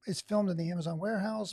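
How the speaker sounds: usual speaking voice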